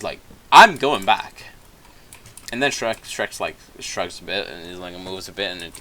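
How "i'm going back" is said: much louder, firm and stubborn; louder insistent repeat. Retold or imitated. Donkey refusing to cross the bridge with Shrek, loud and stubborn. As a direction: dig in and say it louder.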